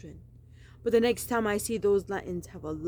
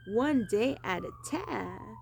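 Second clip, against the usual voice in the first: slow, higher Southern drawl; lightly done Southern accent, higher and slow; put-on Southern accent; 4 semitones higher, slower than usual. Shown as a demonstration. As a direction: lean into a Southern accent, drawn out. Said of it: "That is the other accent I would choose".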